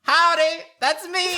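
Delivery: high, bright howdy; cheery cowgirl howdy. Acted. Jessie greets in character.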